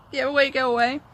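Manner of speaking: put-on retelling shooing a toddler away; mock urgent get away. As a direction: shoo the kid off quickly. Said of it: "I was like".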